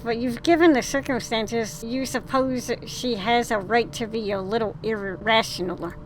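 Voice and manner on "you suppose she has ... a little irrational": ordinary delivery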